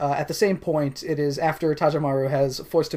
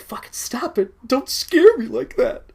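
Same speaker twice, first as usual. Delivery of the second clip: high scared voice; 9 semitones higher, 3 dB louder than usual. Acted. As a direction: plead in a spooked high voice. Acted scared priest.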